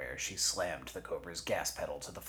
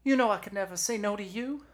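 very high, gushing, swelling loud; smitten dispatcher; 9 dB louder, faster than usual. Acted. Dispatcher agrees to Jesse's favor, high and gushing. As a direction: gush faster and louder.

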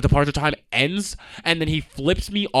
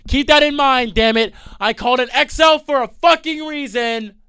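high, loud exasperated shout; shouting the point in a rant; 11 semitones higher, 5 dB louder, slower than usual. Real, not acted. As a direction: yell the point home. Ranting to camera.

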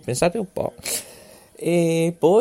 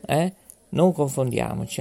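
ordinary delivery

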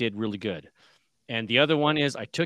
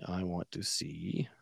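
whispered aside to herself; 5 semitones lower, 7 dB quieter, slower than usual. Real, not acted. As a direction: murmur it under your breath. Muttering to self at screen.

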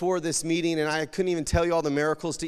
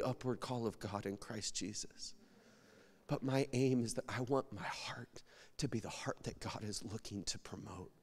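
hushed and earnest; 6 semitones lower, 12 dB quieter than usual. Real, not acted. Earnest testimony, voice drops.

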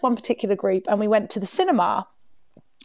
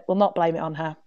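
ordinary delivery

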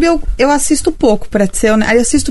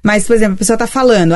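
ordinary talk, nothing marked

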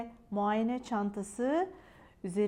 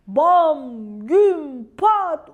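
loud, punched-out sound words; big storybook bangs; booming crash noises; 3 semitones higher, 10 dB louder, much slower than usual. A narrator's reading. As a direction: hit each crash word hard, separately. Crash noises of the landing ambulance.

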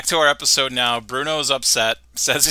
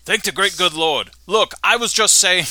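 lightly voiced Aussie caller; 6 semitones higher than usual. [name] on phone.

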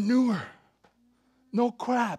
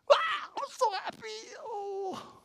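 very high-pitched gushing delight; overjoyed squeal. Acted. God when he sees you, high happy squeal. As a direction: squeal it as if overcome with joy. Acting joy at seeing someone.

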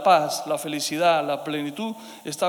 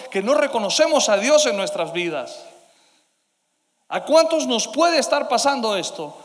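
a louder, higher second take that hits every "no reconocemos"; preaching louder; 4 semitones higher, 5 dB louder than usual. Real, not acted. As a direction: raise the pitch and volume on the repeated "¿A cuántos de nosotros". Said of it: "¿A cuántos de nosotros nos puede estar pasando eso?".

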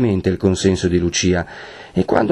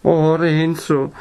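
high, drawn-out tearful moan; struggling through sobs; 8 semitones higher, much slower than usual. A narrator's reading. Lucia to her betrothed, high and tearful. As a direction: moan the name in distress. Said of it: "a stento tra i singhiozzi".

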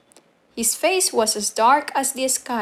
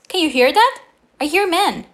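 put-on high, urgent young sailor; raised, higher voice of the cook boy; alarmed cook boy; 7 semitones higher, 5 dB louder than usual. A narrator's reading. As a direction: pitch it up and insist on being heard. Cook boy urgently alerts narrator.

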